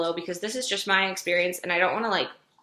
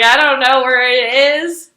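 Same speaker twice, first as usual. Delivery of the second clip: louder, higher, slower, half-laughing panic; flustered retold exclaim; 6 semitones higher, 13 dB louder than usual. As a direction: act out the flustered moment, louder. Retold panic during exam.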